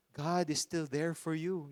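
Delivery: ordinary delivery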